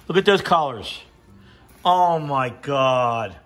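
normal talking voice

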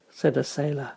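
ordinary delivery, nothing marked

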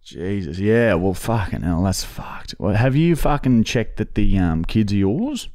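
lightly put-on rough Aussie bloke voice; a hint of a broad Aussie tradie; put-on bogan voice. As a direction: play a crude Aussie bloke on a prank call. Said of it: "this is my real voice. I don't even talk like that".

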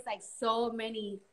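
usual speaking voice

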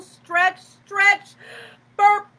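snake's overfull belly bursting, loud and high. Acted. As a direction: ham up the bloated snake.

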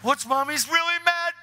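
put-on high, loud alarmed child; acted child panic. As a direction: play a daughter alarmed about Mom. Daughter panics at mother's crying.

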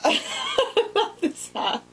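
high, slow laughing delight; giggling with glee. Real, not acted. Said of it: "pure mask hat".

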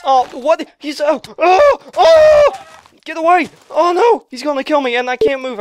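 loud, high, escalating alarm; panicked yelling. Real, not acted. Gamer chased by a raptor chicken, panicked yelling. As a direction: yell in a mounting panic.

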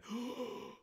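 high hammed-up gasp; sharp high mock gasp; mock shocked gasp. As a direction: fake a dramatic gasp of shock. Mock shock at fake cliffhanger.

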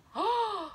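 high, sharp intake of breath; sudden delighted gasp; excited gasp. Real, not acted. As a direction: gasp as if struck by an idea. Sudden matchmaking idea.

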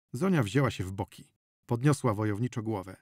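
usual speaking voice

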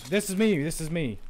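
high, loud urgent call; urgent callout. Real, not acted. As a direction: shout it so they don't shoot you. Calling out position mid-fight.

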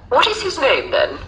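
high-pitched teacher's question. A narrator's reading. Teacher questioning the little girl, high teacher voice.

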